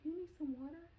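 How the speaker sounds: put-on high-pitched caring child; little daughter. Young daughter who reads her mom, high child voice. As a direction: imitate a small child offering help. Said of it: "She's like".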